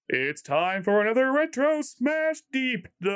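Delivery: big announcer voice. Acted. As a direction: announce the title like a ring call. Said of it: "get my big announcer voice".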